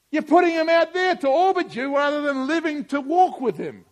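loud, high, driving sermon; preaching at full force. Real, not acted. Pastor urging the church, loud preaching. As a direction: preach it out to the congregation.

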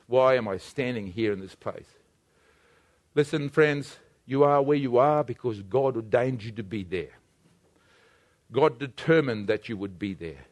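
low, soft, measured pastoral voice; quiet altar call. Real, not acted. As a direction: speak gently to hearts before prayer.

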